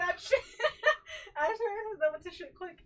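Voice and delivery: loud, high laughter breaking through the words; laughing hard. Real, not acted. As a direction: crack up at the botched accent. Laughing at friend's bad accent.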